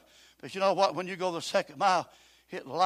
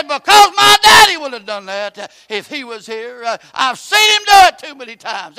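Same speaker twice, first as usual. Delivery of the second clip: shouted retelling; 7 semitones higher, 18 dB louder than usual. Retold or imitated. Son's answer, shouted in sermon.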